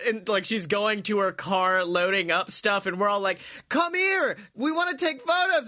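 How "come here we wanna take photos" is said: high calling out; mock eager fans. Fans calling Natalya over for photos, high and eager.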